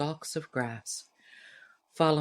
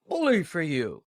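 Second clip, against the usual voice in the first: hearty reply; 4 dB louder than usual. Acted. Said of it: "responded Paul heartily".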